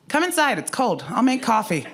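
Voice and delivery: high, sing-out invitation with amusement; retold mom's brisk high-pitched welcome; motherly welcome. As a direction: call it out high, swooping down. Mom welcoming her at the door.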